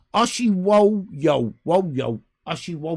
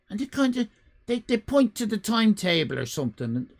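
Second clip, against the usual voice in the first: normal talking voice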